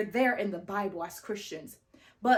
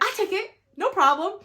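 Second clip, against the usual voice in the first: high, eager market-vendor voice; mock a vendor snapping up a deal, pitched up; imitating the vendor; 10 semitones higher, 6 dB louder than usual. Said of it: "the lady's like".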